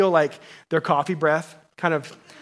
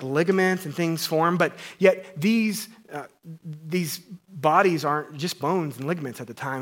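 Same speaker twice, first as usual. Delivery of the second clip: normal talking voice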